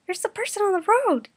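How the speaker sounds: high, loud alarmed cry; high frantic call to stop the car. Acted. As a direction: shout a warning from the back seat. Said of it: "Yukina cried".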